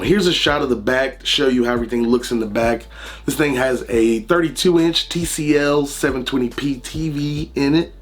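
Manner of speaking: ordinary talk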